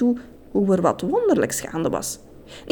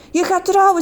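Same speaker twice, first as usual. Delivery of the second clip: higher, excited exclamation; thrilled; 10 semitones higher than usual. A narrator's reading. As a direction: burst out with delight. Sita thrilled.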